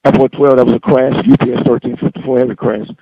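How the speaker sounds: sudden loud urgent report; urgent alarmed call. Real, not acted. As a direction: call it out in real shock. Controller just witnessed a crash.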